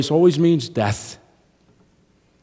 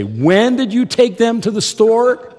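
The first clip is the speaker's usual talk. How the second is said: wife's retort; 11 semitones higher, 7 dB louder than usual. Retold or imitated. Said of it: "because she would say".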